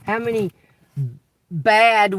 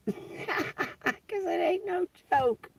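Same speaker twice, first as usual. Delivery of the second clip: hushed; 12 semitones higher, 8 dB quieter, much slower than usual. Real, not acted. Sudden soft emphatic line.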